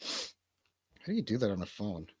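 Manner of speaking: ordinary delivery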